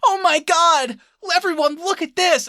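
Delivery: loud, high mock exclamation; hammed-up, drawn-out amazement in a high voice; excited calling out. Imitating a woman showing the diner her phone, excited.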